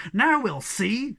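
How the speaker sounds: a slow, challenging call; mild cry. Acted. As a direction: dare them to try. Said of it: "cried Snufkin".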